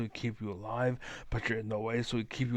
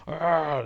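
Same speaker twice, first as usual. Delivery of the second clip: loud, raised mock roar; mock alpha growl; 6 semitones higher, 8 dB louder than usual. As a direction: growl out a fake 'Ah!'. Mimics an overbearing alpha's roar.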